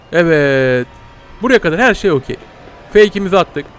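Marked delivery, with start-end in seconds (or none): none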